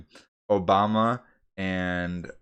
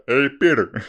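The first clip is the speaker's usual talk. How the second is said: loud, raised cartoon-character greeting; Joe Swan; 4 semitones higher, 8 dB louder than usual. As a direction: hail someone in a put-on cartoon voice. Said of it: "I like to do my, uh, Joe Swan".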